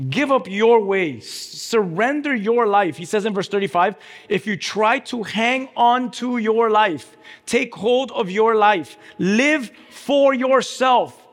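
high, slow, emphatic preacher delivery; forceful preaching. Real, not acted. Preacher urging the crowd to surrender, forceful preaching. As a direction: drive each phrase home to the congregation.

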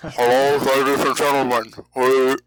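lightly put-on radio announcer; a hint of old-time radio host opening the show. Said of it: "talk in a very deep and low tone".

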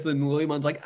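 usual speaking voice

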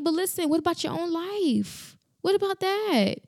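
rising high and impassioned; passionate. Real, not acted. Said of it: "listen, when I'm passionate".

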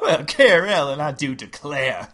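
high Southern belle, loud start; genteel Southern belle exclaiming; Southern lady voice. Acted. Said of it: "we know what a Southern lady sounds like".

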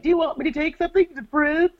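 very high, rushed girlish imitation; mock an over-eager, giggly admirer; put-on squeaky, fast giggly voice; giggly candy striper. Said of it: "she's all giggly".

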